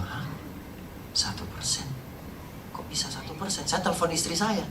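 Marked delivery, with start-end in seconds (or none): 0.0-3.6 hushed, almost whispered shock; stunned disbelief. Retold or imitated. Father hearing the doctor's news, hushed astonishment. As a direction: say it softly, as if unable to believe it. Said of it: "Saya kaget, Bapak Ibu".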